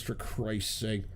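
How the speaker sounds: ordinary delivery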